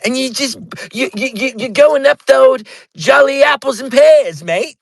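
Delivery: high-pitched, hammed-up British accent; mock British accent. Host mimicking his British guest's complaint, mock British accent. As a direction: take the piss with an overdone British put-on. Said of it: "I am more taking the piss".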